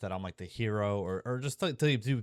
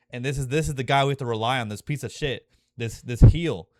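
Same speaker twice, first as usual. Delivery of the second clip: louder, faster, pushing up to a near shout; fired-up excitement; 4 semitones higher, 7 dB louder than usual. Real, not acted. Fan describing the hated father saving everyone, a fast excited near shout. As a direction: blurt it out, caught up in the moment. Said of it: "I'm getting chills".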